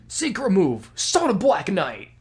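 hammed-up Taskmaster callout; Taskmaster voice line. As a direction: announce it like Taskmaster. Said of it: "Taskmaster's lines are just great".

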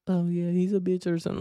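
high mock girly gossip voice; a hammed-up girly sneer; mocking girls. Mocking girls gossiping about him.

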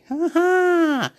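high-pitched drawn-out "ha ha"; high laugh. Real, not acted. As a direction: let out a high, long cackle. Burst of laughter mid-rant.

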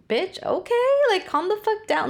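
high-pitched imagined comeback; mock exasperated retort. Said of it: "And it's like".

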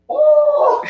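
very high, loud "ohh"; re-enacted squealed "oh", way up in pitch; mock-shocked "oh!". Cashier gawking at the told-off lady, mock-shocked squeal.

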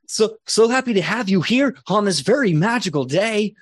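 put-on a proud kid greeting his guests; proud young Gwendol. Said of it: "and he's like".

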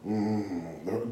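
normal talking voice

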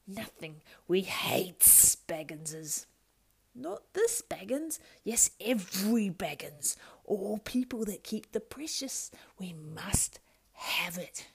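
high whining creature voice shifting to hissed whispers; Gollum voice. Acted. Gollum arguing with himself, wheedling then whispering. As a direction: wheedle and hiss like a split creature.

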